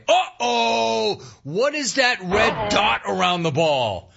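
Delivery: high, drawn-out mock alarm. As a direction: play up the sudden dismay, pitched high and stretched. Acts out the sudden disaster.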